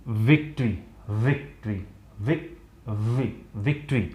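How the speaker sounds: विक्ट्री said softly with a buzzing v; teacher softly drills विक्ट्री; soft victory demo. Shown as a demonstration. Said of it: "तो ये vibrate करता है".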